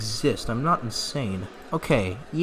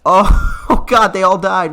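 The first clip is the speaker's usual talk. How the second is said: laughing in shock; 6 semitones higher, 11 dB louder, slower than usual. Real, not acted. Realizes the house burned.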